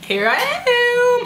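high, long drawn-out announcement; big stretched ta-da call; sing-song reveal. Pretend. Playful reveal after "Can you see me.

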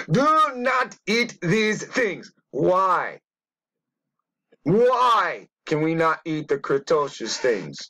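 high, slow mock sermon; mock preacher. A preacher warning about eating shellfish, high and slow. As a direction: boom it like a hammed-up preacher.

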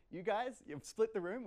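delighted the room split; excited. Real, not acted.

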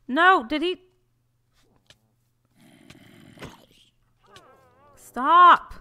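high, loud desperate stop; real alarmed yell; panicked shout. Real, not acted. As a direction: cry out to save the villager.

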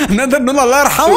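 laughing mock eulogy. Real, not acted. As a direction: joke through a laugh. Teammate falls to his death.

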